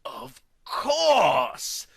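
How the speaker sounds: high, slow, shouted revelation; hammed-up melodramatic epiphany; dramatic realization. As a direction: gasp it out as if everything clicks.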